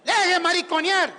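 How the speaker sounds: loud, high scolding shout; blunt, punchy yell; forceful. Shown as a demonstration. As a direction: tell them off hard. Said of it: "pero fuerte".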